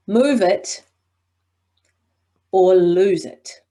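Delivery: "Move it", long pause, then "or lose it" slow and loud; suspense. Shown as a demonstration. Coach demonstrating suspense with a big pause. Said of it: "big pause before the second part".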